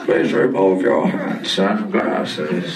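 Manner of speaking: deep slow ride announcement; low announcer voice. Acted. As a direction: drop low and announce slowly.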